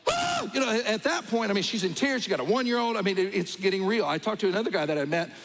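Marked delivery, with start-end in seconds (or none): 0.1-0.5 very high panicked cry of a frightened daughter; a sudden shriek at the sight of a life vest; imitated scream. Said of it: "And she's like".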